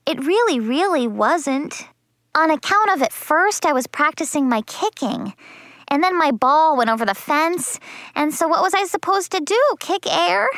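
put-on high, pleading child explaining away blame; indignant kid's self-defense; defensive excuses. Child making excuses to mother.

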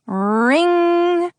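high, held phone ring. A narrator's reading. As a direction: imitate a phone ringing, drawn out and high. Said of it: "The phone was ringing again".

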